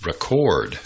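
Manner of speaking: Record as re-CORD, the verb; weak first syllable, full stressed CORD in Record; stress on second syllable. Shown as a demonstration. Teacher shows learners the verb, Record stressed on second syllable. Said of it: "there is a verb also".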